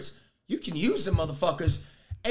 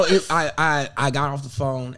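ordinary talk, nothing marked

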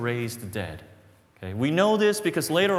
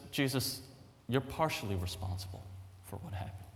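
soft accusation; 8 dB quieter than usual. Acted. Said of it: "She says".

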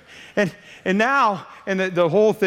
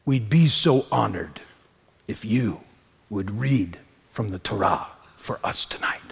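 hushed request; 8 semitones lower than usual. Retold or imitated. Rabbi quietly asks him to read.

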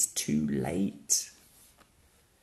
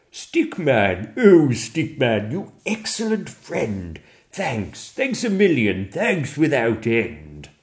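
louder, booming grateful Santa; jolly Santa; 3 semitones higher, 7 dB louder than usual. Acted. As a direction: thank him with a big hearty voice. Santa thanks Stickman.

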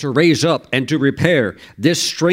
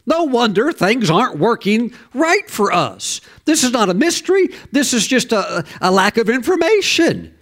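higher, mock dismayed crowd realising their mess; dismayed people; 7 semitones higher than usual. Preacher voicing dismayed people who heard the law. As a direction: voice the people's dawning alarm.

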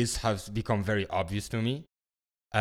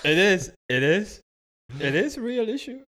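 high, loud, barely holding a laugh; mock earnest through laughter; stifled giggles; 11 semitones higher, 4 dB louder than usual. Real, not acted. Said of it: "I'm trying not to laugh so hard".

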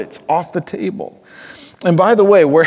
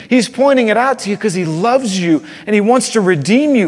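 louder, higher, driving sermon voice; full-force preaching; 9 semitones higher, 5 dB louder than usual. Acted. Pastor pressing listeners to feel their sin, preaching hard. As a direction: preach it hard, pressing each clause home.

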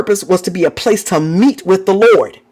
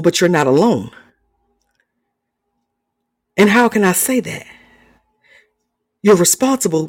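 soft preaching; 5 semitones lower, 3 dB quieter than usual. Real, not acted.

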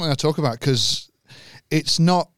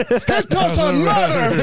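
very high, drawn-out wrestler catchphrase; The Rock; 12 semitones higher, much slower than usual. Acted. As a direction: boom it slowly through a laugh. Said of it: "Imagine Rock working at McDonald's".

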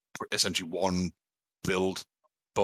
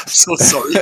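helpless laughing apology; higher, louder, broken up by laughing; already laughing; 5 semitones higher, 8 dB louder than usual. Real, not acted. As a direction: apologise through real laughter. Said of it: "Sorry, I'm already laughing".

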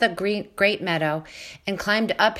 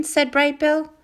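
ordinary delivery, nothing marked

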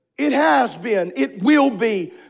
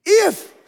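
If pitched high and leaned on; stress on IF; 9 semitones higher than usual. Shown as a demonstration. Preacher stressing the word, a high ringing If. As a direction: hold If up high. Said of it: "placing emphasis on the word if".

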